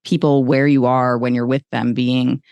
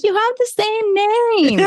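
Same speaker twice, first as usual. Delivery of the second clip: very high, slow mock voice; dopey high parody of the news people; mocking the outlet; slower than usual. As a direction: drag it out high and silly like a clueless reporter. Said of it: "And they're like".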